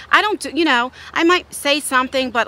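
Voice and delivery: ordinary talk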